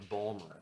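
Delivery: "Balmer" as BAL-mer, dropping the middle; local pronunciation. Shown as a demonstration. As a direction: spell it out then say "Balmer" low. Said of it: "B A W L M E R".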